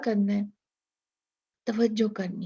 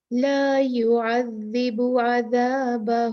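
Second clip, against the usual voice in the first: very slow chanted verse; Quran recitation; much slower than usual. Acted. Teacher reciting the second example, slow and chanted. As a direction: recite slowly, stressing each ذ.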